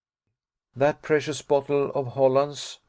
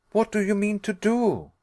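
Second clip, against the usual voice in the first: cried; 8 semitones higher than usual. A narrator's reading. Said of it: "I cried".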